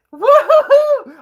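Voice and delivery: loud, drawn-out falsetto hoots of laughter; hooting, squealing laugh; high whooping laugh. Real, not acted. Laughing at awkward scene.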